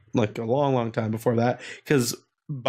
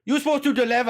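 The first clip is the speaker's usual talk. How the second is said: mock restaurant staff; 9 semitones higher, faster than usual. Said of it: "I'm like".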